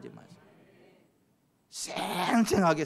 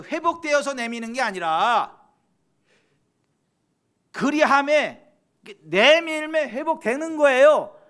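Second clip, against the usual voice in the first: high, loud sermon cry with drawn-out words; full-force preaching; 10 semitones higher, 10 dB louder, slower than usual. Real, not acted. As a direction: preach it forcefully to the whole congregation.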